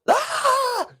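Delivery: high, short mock scream; acted startled cry. Player's character jolts back to consciousness, high startled cry. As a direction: jolt awake with a high yelp. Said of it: "I let out this".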